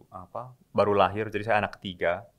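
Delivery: ordinary talk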